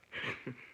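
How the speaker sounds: short breathy chuckle; real laugh. Real, not acted. Recalling that he flipped out upstairs, amused chuckle. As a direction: chuckle to yourself at the memory.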